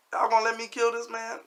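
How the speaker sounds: high-pitched, incredulous mock holler; retold yelling to neighbors. Pleading with neighbors mid-fight, high strained holler. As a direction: call it out high to the whole block. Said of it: "I start yelling to the neighbors".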